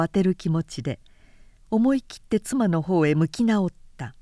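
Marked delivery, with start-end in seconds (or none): none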